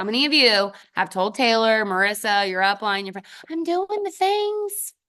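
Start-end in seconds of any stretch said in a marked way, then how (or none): 3.5-4.9 high, drawn-out singsong whine of a claim; stretched-out, high-pitched mocking quote; mock boast. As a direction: mimic them in a high, slow voice. Mimicking team members' boast.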